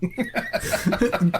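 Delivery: high burst of laughter. Real, not acted. GM laughing at the gravy joke, high and bright.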